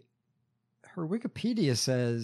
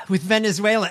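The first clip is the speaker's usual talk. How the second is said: sighing, higher and louder Venezuela; mock imitation; 7 semitones higher, 7 dB louder, faster than usual. Mocking how she said Venezuela, hammed up. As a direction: ham up the sigh before Venezuela. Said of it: "she's looking down like".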